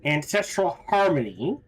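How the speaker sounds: ordinary talk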